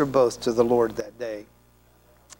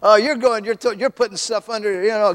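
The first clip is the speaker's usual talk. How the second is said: hurried, high mock-panicked chatter; playful panic before the preacher arrives; 7 semitones higher, 3 dB louder than usual. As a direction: act flustered, scrambling to hide things. Panicked host hiding stuff.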